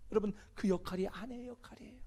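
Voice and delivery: soft, hushed aside to the congregation; whispered point. Real, not acted. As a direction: whisper it like a confidence.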